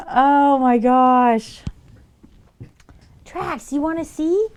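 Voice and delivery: excited pet talk. Real, not acted. Owner squealing as her cat hisses at the toy fish. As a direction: squeal at the animals with delight.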